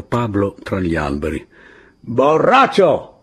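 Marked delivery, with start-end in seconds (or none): 2.0-3.1 high, loud, drawn-out yell; shouted insult. A narrator's reading. Woman shouting at Pablo among the trees, shouted insult. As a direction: yell it slowly across the trees. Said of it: "gli gridò".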